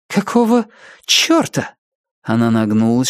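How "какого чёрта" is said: puzzled exclamation. A narrator's reading. Aza suspects a trick.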